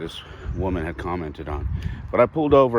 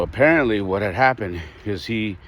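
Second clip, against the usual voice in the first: normal talking voice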